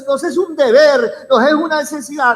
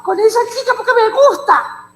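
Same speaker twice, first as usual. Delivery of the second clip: high-pitched, hammed-up infatuated youngster; mock a child's headstrong crush; lovestruck son or daughter; 10 semitones higher than usual. Hijo encaprichado insisting to a parent on marrying, high mocking voice. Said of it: "entra con esa locura que".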